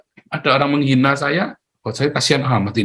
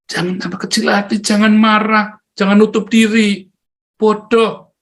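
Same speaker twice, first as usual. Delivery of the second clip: fervent exhortation; 9 semitones higher than usual. Real, not acted. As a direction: press them hard not to give up. Preacher exhorts the fallen.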